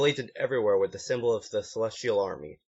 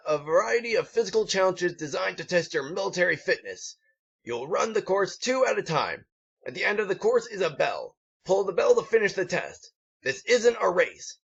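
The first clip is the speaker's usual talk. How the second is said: higher, pompous drill sergeant voice; gruff drill instructor; 9 semitones higher than usual. A narrator's reading. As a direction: address the recruits like a proud sergeant. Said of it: "The gruff, olive green pony".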